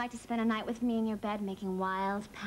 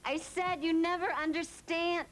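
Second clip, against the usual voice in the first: higher, emphatic repeat with NEVER stressed; exasperated repeat; 4 semitones higher than usual. Acted. Daughter repeating herself to a mother not listening, exasperated. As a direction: say it over, pitched up and insistent.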